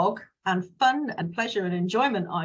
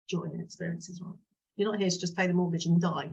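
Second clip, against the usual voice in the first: ordinary talk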